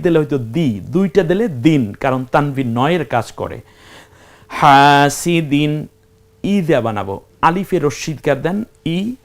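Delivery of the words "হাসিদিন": slow demo reading. Shown as a demonstration. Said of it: "demonstrates word slowly".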